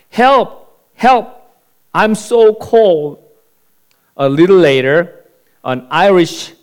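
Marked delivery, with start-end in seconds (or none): 0.1-3.2 high, loud cry for help from a pit; shouting for help. Acted. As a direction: call out desperately to be heard. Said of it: "So he shouted".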